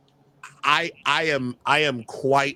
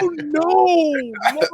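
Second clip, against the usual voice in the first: mock horror; 12 semitones higher, 6 dB louder than usual. Real, not acted. Horrified at imagined hairstyle.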